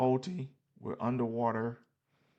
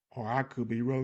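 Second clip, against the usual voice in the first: talking through a yawn. Real, not acted. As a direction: yawn while speaking. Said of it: "Excuse me for ya-, yawning".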